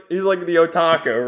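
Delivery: usual speaking voice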